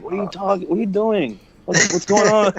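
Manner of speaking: high-pitched mock girlfriend voice; imitating girlfriend. Girlfriend questioning him as he wakes up yelling, imitated in a high voice. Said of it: "my girlfriend was like".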